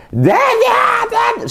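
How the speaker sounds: put-on a child yelling for her father from another room; child calling Dad. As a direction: shout it like a little girl calling across the house. Youngest daughter calling him.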